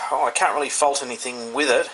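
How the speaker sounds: ordinary talk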